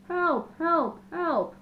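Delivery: loud, high bellow for help. A narrator's reading. Elephant stuck in a ravine bellowing for help. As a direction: call out with all your lungs. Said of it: "he bellowed".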